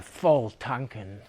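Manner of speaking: slow, low, softened sigh of a phrase; hammed-up dejection, trailing down; mock downcast. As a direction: say it as if things went badly. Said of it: "when things were bad".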